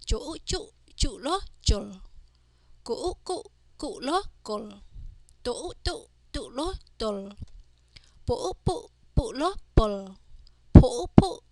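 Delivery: teacher's sing-song spell-and-read drill. Shown as a demonstration. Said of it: "let's practice reading these words".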